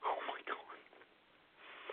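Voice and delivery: breathy, hushed oh my God under the breath; real whispered disbelief; stunned whisper. Real, not acted. Shocked reaction to stranger's warning.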